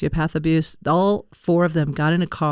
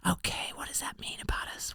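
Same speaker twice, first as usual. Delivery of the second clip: stunned whisper; 16 dB quieter than usual. Real, not acted. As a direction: whisper in amazed disbelief. Amazed by shared coincidence.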